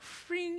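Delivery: high-pitched ringing blade noise; unsheathing sound. Retold or imitated. Doctor pulls out needle like a sword, ringing sound. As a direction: imitate a sword being drawn. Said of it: "it was like he unsheathed it".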